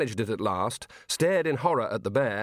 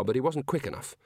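ordinary delivery

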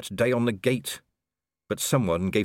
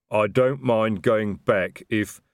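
slow, louder troll rumble; Detritus, rumbling; 2 semitones higher, 5 dB louder, slower than usual. A narrator's reading. Troll sergeant offers to turn back, slow rumbling voice. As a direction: rumble it out heavily, like a big troll. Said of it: "Detritus made a rumbling noise".

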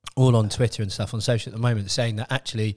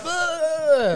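disgusted grunt; 14 semitones higher than usual. Retold or imitated. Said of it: "he's like".

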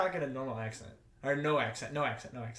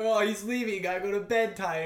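higher, mildly mocking quote of friends; mock teasing; 5 semitones higher, 4 dB louder than usual. Guest mimics hosts teasing him for leaving early, mocking. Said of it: "You're like".

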